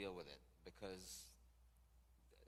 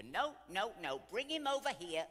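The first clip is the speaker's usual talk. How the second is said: put-on loud, high, bossy old friend calling out; 11 semitones higher, 11 dB louder than usual. Golden girl calling across the restaurant to hostess, bossy.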